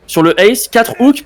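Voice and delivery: ordinary delivery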